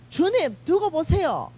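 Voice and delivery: high, loud boastful vow; fiery vow. Acted. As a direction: swear loyalty like hot-headed Peter, pitch high. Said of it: "이러고 난리 났을 거예요". (성질 급한 베드로처럼 높은 목소리로 맹세하기)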